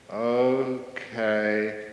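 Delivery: one word at a time, long pauses; dreamily slow, syllables stretched out; ultra-slow talker. Acted. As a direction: speak at a tortoise's pace. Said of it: "who can't even talk fast".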